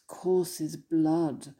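ordinary talk

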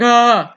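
high mock wail of a crying girl; short imitated sob-cry. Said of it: "And she's like".

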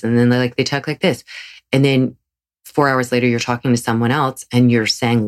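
ordinary delivery

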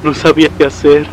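pleading, overwhelmed and desperate; distressed, breaking down. Acted. Father phoning his family from the haunted agency, distressed.

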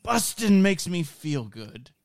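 slow, high, pure and innocent; innocent Paul Rudd. Retold or imitated. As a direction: deliver it with wide-eyed innocence. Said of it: "he just delivers it, like, so innocent and purely".

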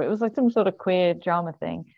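ordinary talk, nothing marked

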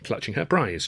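plain reading voice

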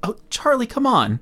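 a mock sigh of a father fed up with his kid; annoyed dad. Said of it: "gets pretty clearly annoyed".

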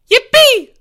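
very high, loud gleeful squeal. A narrator's reading. Boy cheering the poem's end, a very high loud squeal. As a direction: squeal with pure delight. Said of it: "squealed Porge".

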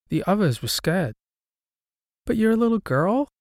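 put-on ghost girl voice.

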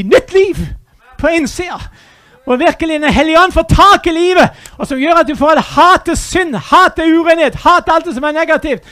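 loud, hammering each "hat"; fervent preaching. Acted. Preacher urging hatred of sin, loud and fervent. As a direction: preach it with fire, pounding "hat".

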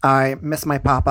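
ordinary talk

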